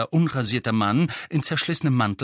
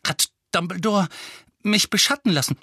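higher, broken question while winded; breathless, halting question; panting; 7 semitones higher than usual. A narrator's reading. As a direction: ask it between puffs. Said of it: "keuchte Harry".

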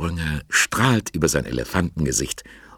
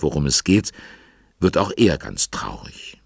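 ordinary talk, nothing marked

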